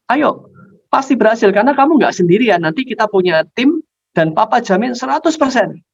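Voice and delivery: high, eager persuasion; coaxing father. Acted. Father persuading his child to join the heist, coaxing.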